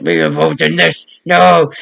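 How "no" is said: ordinary delivery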